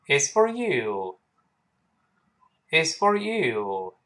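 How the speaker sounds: slow model line with "you" given full weight; stress on YOU. Shown as a demonstration. Teacher models the answer for students, "you" slow and stressed. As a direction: lengthen "you", full vowel, never weakened. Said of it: "注意you有重读".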